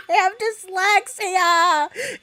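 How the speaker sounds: slow words through giggles; laughing. Real, not acted. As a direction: laugh it out slowly. Laughing confession, slowed.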